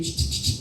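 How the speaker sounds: soft hushing sound, calming; soothing shush. Acted. Jesus calming frightened disciples, soft shushing. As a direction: shush them gently. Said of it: "Entonces dijo".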